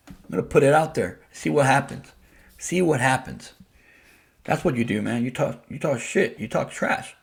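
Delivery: ordinary talk, nothing marked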